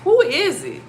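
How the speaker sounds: high and loud "who is it"; alarmed, calling out to the presence; startled. Retold or imitated. Waking up in her ex's position, a high startled call. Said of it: "So I'm like".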